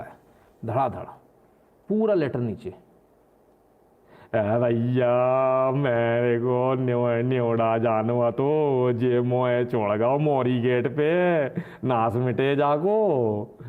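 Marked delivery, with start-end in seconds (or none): 4.3-13.6 slow, put-on rustic woman's plea in dialect; slow mock dialect complaint; mock village woman. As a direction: play a stranded village lady begging. Mock village woman's letter voiced.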